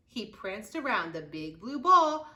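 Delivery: usual speaking voice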